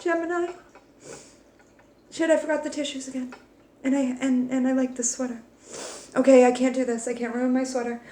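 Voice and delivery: soft, choked-up voice near tears; fighting back real tears over her sweater; tearful. Real, not acted. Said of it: "I'm about to cry for Gemini".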